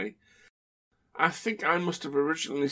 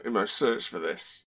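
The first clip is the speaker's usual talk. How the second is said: ordinary delivery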